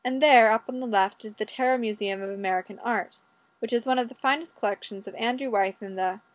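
ordinary delivery, nothing marked

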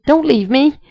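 high, loud panicked plea; blurted, almost hysterical. A narrator's reading. Rodney clings to John, blurting a panicked plea. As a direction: blurt it out, desperate not to be left. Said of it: "tightened almost hysterically ... as he blurted".

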